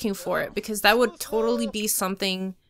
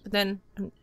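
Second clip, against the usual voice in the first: ordinary delivery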